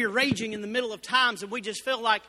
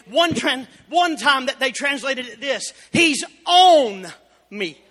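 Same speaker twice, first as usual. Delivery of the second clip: full-force preaching; 4 semitones higher, 10 dB louder than usual. Acted. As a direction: thunder it out from the pulpit.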